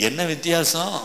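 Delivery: ordinary talk, nothing marked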